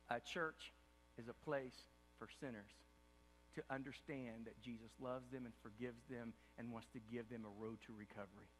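normal talking voice

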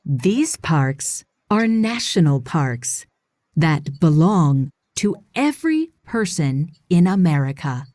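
ordinary delivery

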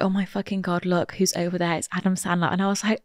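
soft, low, hushed gasp of disbelief. Retold or imitated. Boyfriend spotting Adam Sandler at breakfast, hushed. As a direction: hush it so he can't hear. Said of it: "he was like".

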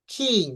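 "কিং" with a puff after the K; aspirated K. Shown as a demonstration. Teacher confirming it goes like this, aspirated K. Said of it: "হ্যাঁ, এরকম".

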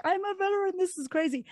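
high, mock-proud voice, well above her own; teasing brag in a put-on high voice; mock proud veteran. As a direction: puff up and play the proud veteran. Joking about her veteran status.

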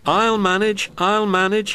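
high, strained effort; wheezing. A narrator's reading. Old engine struggling uphill, high strained wheeze. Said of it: "he wheezed".

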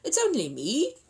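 high-pitched little bear voice; Paddington. Acted. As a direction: pipe up from the doorway. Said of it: "somewhat muffled voice from the dining-room doorway".

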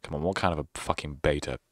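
ordinary delivery

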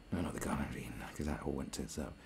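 breathy whispered correction; a sly under-the-breath aside. Real, not acted.

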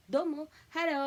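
hello said as haro, with an r and no L; Japanese kids' hello. Retold or imitated. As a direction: imitate Japanese children's haro. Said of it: "sa mga bata dito".

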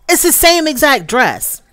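high, disbelieving retort; incredulous, stressing same; high pitched can't-believe-it protest; exasperated. Retold or imitated. Her own exasperated retort.

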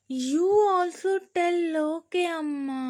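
put-on high, slow child asking mom to agree; a small girl coaxing her mother; little girl voice. A narrator's reading. Child character voice. (అమ్మను ఒప్పించే చిన్న పాప గొంతు)

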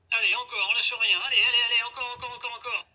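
loud, high hype shouting; gym bro. Acted. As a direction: push them hard through the final reps. Coach cheering on the last reps.

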